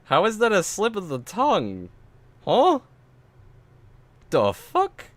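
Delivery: high-pitched baffled questioning; stunned squeak of confusion; incredulous. Real, not acted. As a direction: throw the excuse back in disbelief. Baffled by the excuse just quoted.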